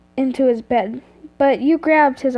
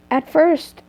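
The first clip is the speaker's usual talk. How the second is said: usual speaking voice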